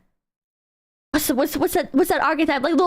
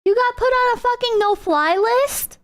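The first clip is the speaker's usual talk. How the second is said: imitating her; 6 semitones higher, slower than usual. Streamer mimics the arrested woman, higher whiny complaint. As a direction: whine it the way she would. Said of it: "I sounded like her!".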